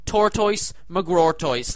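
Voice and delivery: ordinary talk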